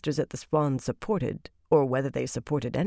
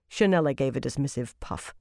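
ordinary delivery

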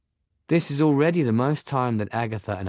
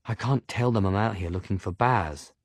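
ordinary delivery, nothing marked

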